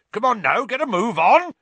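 loud, high hearty call. A narrator's reading. Hagrid hurrying his approaching class along, calling out. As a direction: call across to an approaching crowd. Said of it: "he called".

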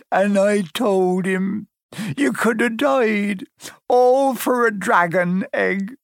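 high, slow, remorseful wailing; sobbing. A narrator's reading. Hagrid confessing his blunder to Harry, sobbing. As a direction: blubber it out, drawing out the words. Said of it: "he sobbed, his face in his hands".

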